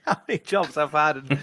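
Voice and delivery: amused self-mocking laugh while talking. Real, not acted. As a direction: chuckle as you list your many jobs. Laughing at own many jobs.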